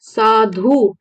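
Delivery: साधु in two drawn-out syllables, ending on a held उ; final vowel shown. Shown as a demonstration. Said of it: "छोटा उ". (साधु का आख़िरी उ खींचकर)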